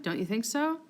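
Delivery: ordinary delivery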